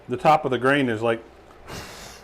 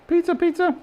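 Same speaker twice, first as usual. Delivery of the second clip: sing-song call; 4 dB louder than usual. Acted.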